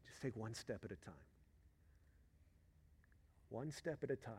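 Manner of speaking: very soft, spaced-out counsel; quiet, gentle pulpit aside; hushed encouragement. Acted. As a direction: drop to a hush for the key line. Preacher hushes after climax.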